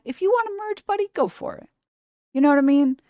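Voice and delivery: high, sing-song "go for it, buddy"; mock generous. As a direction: sweetly wave the other driver on. Mock-generous driver talk.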